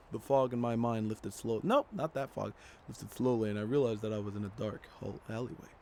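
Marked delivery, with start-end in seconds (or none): 2.9-5.7 quiet, even reading of game text; story narration. A narrator's reading. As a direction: read the story calmly to the audience. Reads the game's story text.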